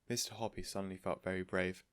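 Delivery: ordinary delivery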